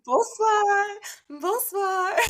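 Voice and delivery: high, sing-song bonsoir; cheerful bonsoir greeting. Retold or imitated. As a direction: chirp it to everyone you pass. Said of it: "Jared's like".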